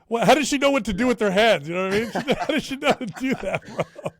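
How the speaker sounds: high, laughing amazement; amazed disbelief. Real, not acted. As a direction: marvel at it, half laughing. Daughter raising hands.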